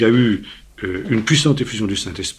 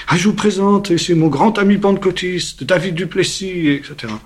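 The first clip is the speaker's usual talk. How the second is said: higher and louder, presenting his friend to everyone; loud introduction; 9 semitones higher, 5 dB louder than usual. Retold or imitated. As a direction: proudly introduce him to the whole crowd. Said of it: "Il a dit ça d'une voix forte". (présentation forte et aiguë, avec fierté)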